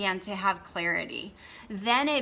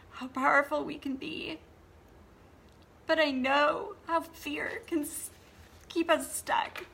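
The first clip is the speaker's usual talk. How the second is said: high, tearful voice that keeps cracking; crying; 4 semitones higher than usual. Real, not acted. Coach tearfully telling viewers she wants better for them. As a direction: push through the tears to say it. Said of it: "didn't mean to cry".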